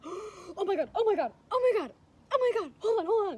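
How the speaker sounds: high, loud frantic exclamations; excited mom. Retold or imitated. Woman imitating her mom guessing the engagement, wildly excited. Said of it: "my mom was just so excited".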